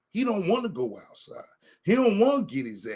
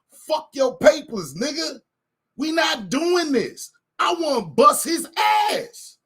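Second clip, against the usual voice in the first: heated refusal; 7 semitones higher, slower than usual. Retold or imitated. Brushing off the complaint forms, slow heated rant. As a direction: spit out the refusal, each word weighed.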